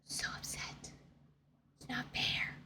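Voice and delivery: soft unvoiced whisper of It's not fair; whispered sulk; whispered complaint. Real, not acted. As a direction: breathe it out like a sulking secret. Whisper then shout contrast.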